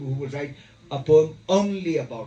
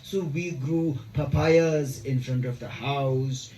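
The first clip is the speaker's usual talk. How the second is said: slow, measured poem reading with long pauses; solemnly paced verse recital; poetry recitation; slower than usual. Acted. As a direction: draw out the line endings, let each image land.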